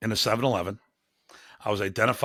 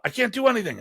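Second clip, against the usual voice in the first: flustered driver; 11 semitones higher, faster than usual. Retold or imitated. Frustrated driver at parking gate.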